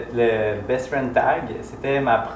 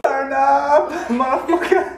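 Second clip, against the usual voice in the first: excited shout; 13 semitones higher, slower than usual. Real, not acted. Hyped toast after shot.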